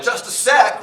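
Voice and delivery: high loud panicked shout; panicked call. Retold or imitated. Caught guy yells to coworker pounding on door, panicked. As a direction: yelp it through the door.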